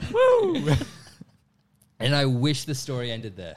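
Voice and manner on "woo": high, loud joyful whoop. Real, not acted. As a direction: cheer like you've just been freed. Celebrating being let go.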